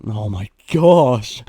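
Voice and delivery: drawn-out, exasperated groan of words; fed up at a botched take. Real, not acted. Exasperated at fluffing the line.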